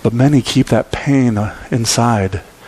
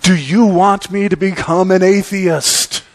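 raised, emphatic mock-desperate plea; Calvin's desperate cry; 5 semitones higher than usual. Calvin looks up at God in the final frame, desperate cry. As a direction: cry it up to God, higher and punched. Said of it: "cries out in utter desperation".

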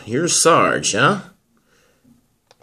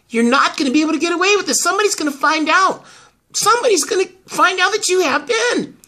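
higher, urgent confrontation; agitated warning; 8 semitones higher, faster than usual. Acted. Confronting a woman who took the baby, agitated warning. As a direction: push the warning out, pitched up.

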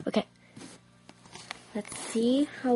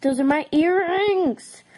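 higher put-on voice, playing offended; mock indignation; 6 semitones higher, 3 dB louder than usual. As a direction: claim them in a high pretend-cross voice. Acted quarrel over earrings.

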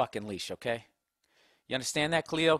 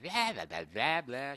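empty chatter imitated mockingly; mock their empty talk as droning blahs; mocking yammer; 5 dB quieter, slower than usual. Man imitating women chatting in the kitchen, mocking yammer. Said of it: "Yammering on".

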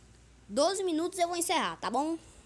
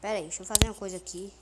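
usual speaking voice

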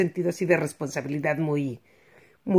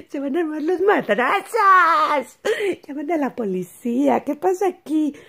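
higher, mock alarmed inner voice; mock alarm, raised pitch; alarmed thought; 7 semitones higher than usual. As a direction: pretend you're startled by a fight. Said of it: "así yo dije".

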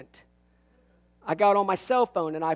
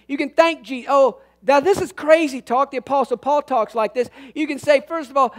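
usual speaking voice

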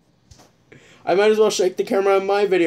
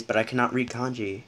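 ordinary talk

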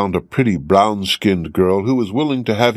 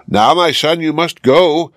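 higher, urgent call to leave; a mild raised cry to send him off; old woman hurrying the young man away, higher and urgent; 7 semitones higher than usual. A narrator's reading. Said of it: "cried the old woman".

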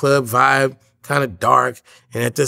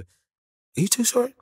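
high, slow, wide-eyed question; lightly voiced starstruck shoe clerk; starstruck fan; 11 semitones higher, 4 dB quieter, slower than usual. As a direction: imitate an excited fan recognizing you. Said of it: "the dude at the Foot Locker selling me the shoe going".

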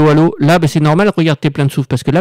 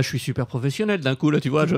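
normal talking voice

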